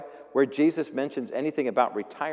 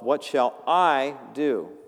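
slow, louder emphatic reading of the rich man; slow scripture reading; 5 dB louder, slower than usual. Acted. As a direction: lean on each word of the rich fool. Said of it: "reading the passage".

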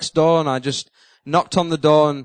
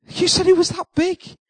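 high mocking voice; teasing wife; 12 semitones higher than usual. Wife teasing her husband about the rat, mock high voice. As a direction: poke fun at his fear.

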